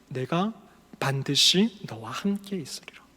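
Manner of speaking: soft, slow, unvoiced whisper; hushed promise. Preacher voicing God's promise to Moses in a hushed whisper.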